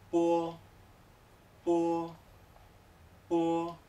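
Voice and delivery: po said with a tiny u glide before the o; Chinese syllable po. Shown as a demonstration. Teacher models po for Spanish learners, slow and clear. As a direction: start po from small rounded lips, opening to o. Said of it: "se pronuncia".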